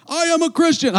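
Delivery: high mock announcement from a desk; mock proclamation. Mocking someone standing on a desk to proclaim faith, high. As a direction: ham up a preachy declaration. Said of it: "That doesn't mean I stand on the desk".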